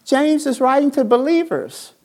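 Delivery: preacher building to a peak; preaching. Real, not acted. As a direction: preach it to the congregation. Preaching at full force.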